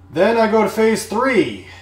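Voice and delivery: high, loud grand declaration; dramatic announcement. Real, not acted. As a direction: announce the next move like a battle plan. Announcing escalation plan.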